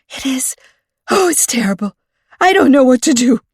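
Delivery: high, rising to a loud frantic cry; panicking, close to losing it. A narrator's reading. Frantic librarian blurts her panic to Callie, high and loud. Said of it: "She looked frantic".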